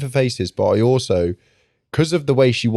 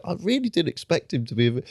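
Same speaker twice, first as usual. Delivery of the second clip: a touch higher, softer confiding voice; mock confession; 8 semitones higher, 6 dB quieter than usual. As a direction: admit it sheepishly, half-hushed. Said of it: "And you're like".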